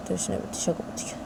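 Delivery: ordinary delivery, nothing marked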